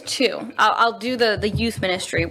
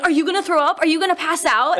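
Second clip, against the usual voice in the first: high teasing class voices; mock kids teasing; 8 semitones higher than usual. Laughing kids teasing their teacher, high and mocking. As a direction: tease the squeamish teacher.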